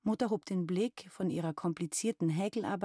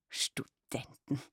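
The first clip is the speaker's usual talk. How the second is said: slow, aghast whisper; mother whispering in shocked horror; horrified; 2 semitones lower, much slower than usual. Acted. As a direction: breathe the word out as if it were a scandal. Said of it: "rief sie entsetzt aus".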